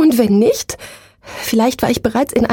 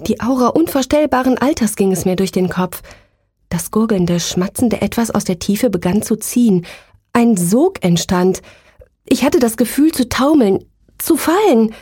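ordinary delivery

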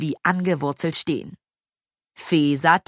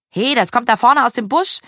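high, loud, excited shout; excited call; 7 semitones higher, 6 dB louder than usual. A narrator's reading. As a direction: call out a sudden discovery. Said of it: "rief Maya aufgeregt".

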